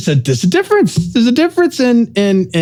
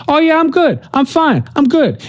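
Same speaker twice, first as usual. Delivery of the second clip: much higher, airy "I'm good" act; breezy brush-off; 10 semitones higher than usual. Retold or imitated. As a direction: mimic someone brushing you off. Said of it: "people who started off saying".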